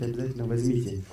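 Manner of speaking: ordinary talk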